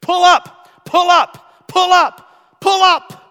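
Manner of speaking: very loud, high synthesized cockpit alarm; shrill computer voice. Retold or imitated. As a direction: repeat it like a blaring automated warning. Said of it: "there was a shrill computer synthesized voice".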